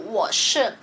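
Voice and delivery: ordinary talk, nothing marked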